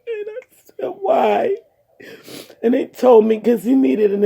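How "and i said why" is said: crying. Real, not acted. Asking doctor why, crying.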